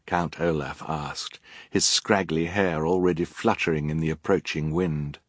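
usual speaking voice

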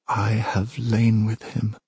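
slow, restrained confession; slow, deliberate. Acted. Princess restates her claim to the goddess, slow and deliberate. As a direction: spell it out slowly at your own pitch.

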